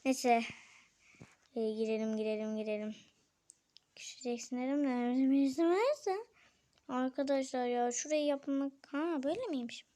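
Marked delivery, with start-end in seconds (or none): 4.0-4.6 fısıltı. Real, not acted. Kendi sözünü fısıldayarak tekrarlar.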